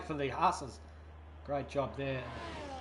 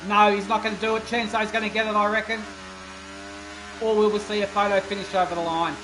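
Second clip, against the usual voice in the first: live race commentary; 4 semitones higher, 4 dB louder than usual. Acted.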